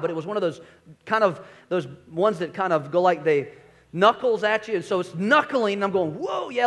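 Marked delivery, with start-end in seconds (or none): 6.1-6.7 high, quick mock-startled "Whoa, yeah"; imitating a fielder caught off guard. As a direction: yelp it as the knuckleball comes at you. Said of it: "like that".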